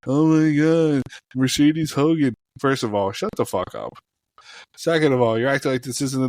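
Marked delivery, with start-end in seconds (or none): 0.1-2.3 higher-pitched mock dismay, imitating upset fans; mock outrage. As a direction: whine it like overwrought fans. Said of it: "'Cause they're like".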